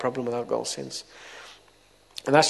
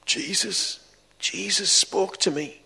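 higher, slow, hushed in wonder; awed and emotional; 5 semitones higher, slower than usual. Retold or imitated. Brother telling what happened to him, slow and awed. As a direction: tell it as if still overwhelmed.

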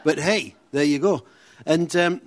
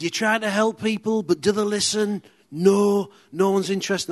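higher, louder grumbling rant; ranting old man; 7 semitones higher, 4 dB louder than usual. Retold or imitated. Old man complains to the bus that nobody listens, loud grumble. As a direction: moan to the whole bus.

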